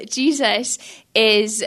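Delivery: usual speaking voice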